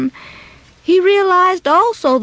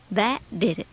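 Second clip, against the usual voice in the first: ordinary delivery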